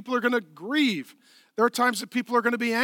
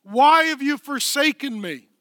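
anguished cry, much louder; 5 semitones higher, 6 dB louder than usual. Acted. Jesus crying out to the Father on the cross, loud anguish. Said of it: "Jesus cried with a loud voice".

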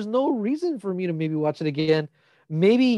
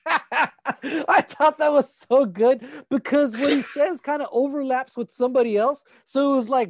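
real laughter; 6 semitones higher than usual. Real, not acted. Cracking up recalling the scene.

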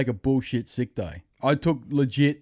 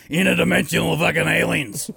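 higher-pitched conspiracy outburst; Alex Jones impression; 4 semitones higher, 4 dB louder than usual. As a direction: announce the alien threat like a ranting host. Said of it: "doing on Alex Jones".

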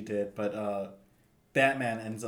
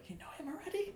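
surprised; 14 dB quieter, faster than usual. Retold or imitated. Her surprised reply retold.

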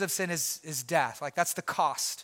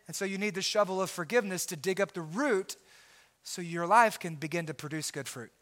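normal talking voice

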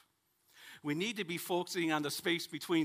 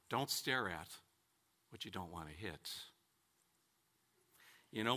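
ordinary talk, nothing marked

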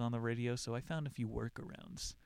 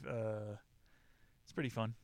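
ordinary talk, nothing marked

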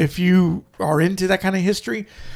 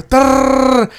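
machine-gun rattle; 6 semitones higher, 5 dB louder than usual. Retold or imitated. Imitating gunfire in retold scene.

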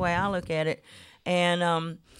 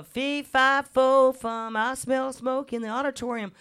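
recited rhyme; 9 semitones higher than usual. Retold or imitated. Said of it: "so I'd learned".

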